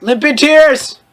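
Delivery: excited band name; excited exclamation. Real, not acted. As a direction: announce it gleefully.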